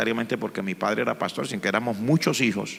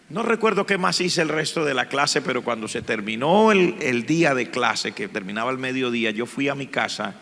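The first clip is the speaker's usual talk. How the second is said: ordinary delivery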